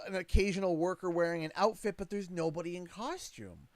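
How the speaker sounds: ordinary delivery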